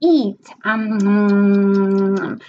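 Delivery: playful circle-and-eat prompt; teacher demo. Shown as a demonstration. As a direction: coax with munching sounds. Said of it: "and then you can say".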